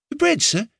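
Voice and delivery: the servant boy offering bread, voiced boyish; young Ned. A narrator's reading. Boy asking his prisoner master, boyish voice.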